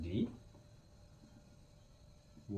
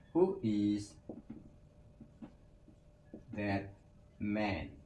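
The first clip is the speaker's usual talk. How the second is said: slow word-by-word model; 5 dB quieter, much slower than usual. Shown as a demonstration. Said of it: "teacher models the question word by word".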